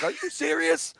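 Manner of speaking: high-pitched, exasperated retort, lightly acted; retold disbelief. Retelling his argument with the DJ, mildly incredulous.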